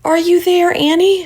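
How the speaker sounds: high call back down the line. A narrator's reading. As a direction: reach her through the wind. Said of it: "she called".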